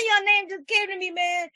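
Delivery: 1 laughing through tears. Real, not acted. Emotional laugh at the story's end.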